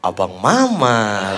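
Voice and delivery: low, very slow, drawn-out realisation; stunned fan. Retold or imitated. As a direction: imitate a fan slowly catching on.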